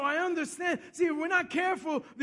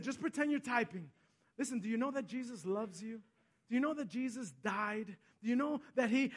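much softer, tender and confiding; gentle witnessing; 7 dB quieter than usual. Retold or imitated. Pastor to a crying clerk at the counter, gentle witnessing. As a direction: imitate a pastor quietly reaching out.

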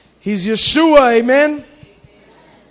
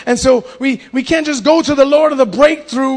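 ordinary delivery

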